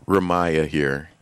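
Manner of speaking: ordinary talk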